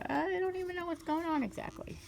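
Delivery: high, thin confused monkey voice; monkey pleading ignorance, small high voice; squeaky monkey. Acted. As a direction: play the bewildered little monkey. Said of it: "the monkey's like".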